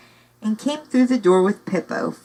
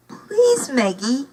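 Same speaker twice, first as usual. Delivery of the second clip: begging; 7 semitones higher, slower than usual. Acted. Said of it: "begged Pippo's voice".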